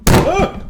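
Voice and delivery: sudden loud high yelp; startled yelp. Real, not acted. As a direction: yelp as the mic falls. Threw mic onto keyboard.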